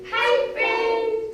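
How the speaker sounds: high, loud sing-song hello; cheerful greeting. Shown as a demonstration. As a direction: sing it out to a friend. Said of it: "look at a friend and say".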